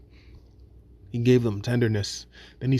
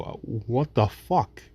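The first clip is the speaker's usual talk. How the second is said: drawn-out, fed-up what the fuck; slow exasperation. Retold or imitated. Cut again, victim slowly asks the abuser, exasperated. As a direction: stretch it out, worn down by the cuts. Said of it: "And you go".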